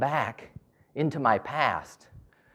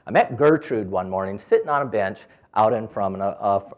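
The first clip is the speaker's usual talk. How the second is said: usual speaking voice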